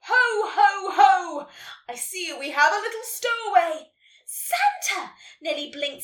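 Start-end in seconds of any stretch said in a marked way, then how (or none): 0.0-3.9 big, booming ho-ho-ho; loud, pitched-up hearty greeting; jolly Santa calling out. A narrator's reading. Santa greeting his stowaway, calling out jovially. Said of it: "a voice called out". 4.2-5.1 very high, loud exclamation; amazed cry. A narrator's reading. Nelly meeting Santa, amazed.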